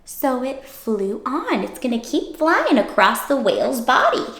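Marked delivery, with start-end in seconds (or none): none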